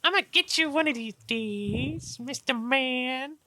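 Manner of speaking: high little voice, dragging out the last words; playful threat. Acted. Half-orc girl vowing revenge on the big man, high playful threat.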